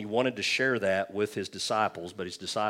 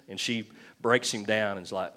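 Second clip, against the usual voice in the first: usual speaking voice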